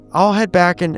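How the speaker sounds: ordinary talk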